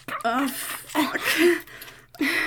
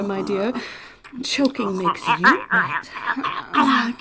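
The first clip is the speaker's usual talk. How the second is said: ordinary talk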